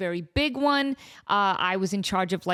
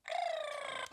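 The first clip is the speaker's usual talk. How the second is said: quick high gasp of relief; high soft gasp of surprise; sharp gasp; 15 dB quieter than usual. Real, not acted. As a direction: gasp as it finally stops. Toy finally stops.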